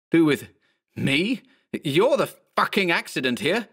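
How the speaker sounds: high, outraged retort; high-pitched offended outburst; aghast indignation. Acted. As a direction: repeat it in disbelief, then snap angrily. Aghast character turns angry at clone.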